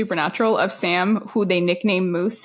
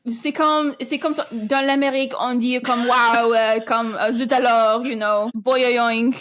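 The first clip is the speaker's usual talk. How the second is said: put-on silly French accent; 2 semitones higher than usual. Mocking the French scribe in Parliament with a silly French accent. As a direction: play an over-the-top French scribe. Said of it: "silly little Frenchman down here being like".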